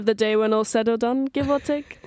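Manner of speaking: put-on lilting Welsh accent, rising at the end; a light attempt at a Welsh accent. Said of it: "you were trying to do a Welsh accent".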